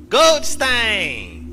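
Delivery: berro agudo e forte de garoto; grito de menino. A narrator's reading. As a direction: shout it as a high-pitched accusing boy. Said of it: "estetorou o menino quando a porta se fechou". (berro estridente de menino)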